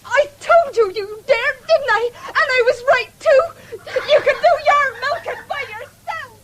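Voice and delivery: loud, mocking taunt with a laugh at the end; jeering shout. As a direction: shout it to humiliate him, half laughing. Girl turns on boy, jeering.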